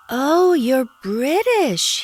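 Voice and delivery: high, slow delighted exclaim; a doctor delighted by a British accent. A narrator's reading. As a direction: gush it out, charmed. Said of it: "he exclaims".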